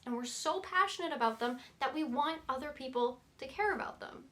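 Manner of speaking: ordinary talk, nothing marked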